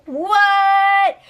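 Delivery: a sudden high, loud "What?"; shocked what. Real, not acted. Vlogger reacting to a surprise phone call, shocked. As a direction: genuine disbelief at the twist.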